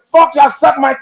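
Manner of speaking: high-pitched defiant taunt, drawn out at the end; retold angry cursing. Jailed man telling off the cops, pissed off. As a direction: re-enact mouthing off at the cops. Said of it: "I was so pissed off".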